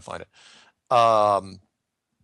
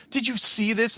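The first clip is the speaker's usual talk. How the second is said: quoting an eager fan; 12 semitones higher than usual. Retold or imitated. Fans emailing him news.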